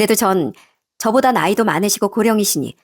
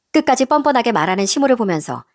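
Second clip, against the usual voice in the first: usual speaking voice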